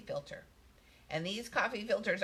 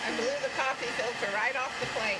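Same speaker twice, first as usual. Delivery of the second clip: raised voice over a loud hairdryer; over hair dryer; 4 semitones higher, 4 dB louder than usual. Real, not acted. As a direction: talk up over the dryer noise. Hairdryer running while she talks.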